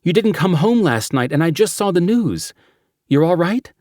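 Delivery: high, urgent, worried voice; anxious partner pressing for news; panicked worry. Acted.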